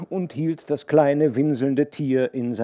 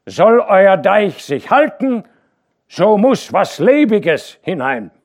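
loud, slow defiant workman; bull-necked worker; 10 semitones higher, 11 dB louder, slower than usual. A narrator's reading. A bull-necked worker defying the dike reeve. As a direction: plant your feet and defy him. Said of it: "stiernackiger Kerl".

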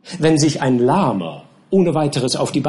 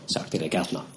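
usual speaking voice

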